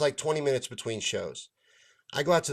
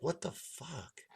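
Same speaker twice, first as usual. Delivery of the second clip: stunned mutter; 4 semitones higher, 12 dB quieter, slower than usual. Retold or imitated. Reaction to tip jar theft.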